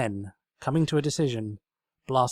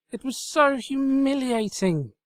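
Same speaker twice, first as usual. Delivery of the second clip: high, slow wail of humiliation; high dramatic lament; cried; 14 semitones higher, 5 dB louder, slower than usual. Acted. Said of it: "she cried".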